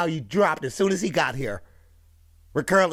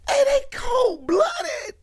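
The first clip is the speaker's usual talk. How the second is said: excited; much slower than usual. Real, not acted. Reacting, laughing, shouting.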